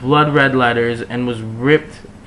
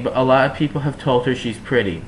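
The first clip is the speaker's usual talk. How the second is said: ordinary delivery, nothing marked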